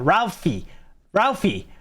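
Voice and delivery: ordinary talk, nothing marked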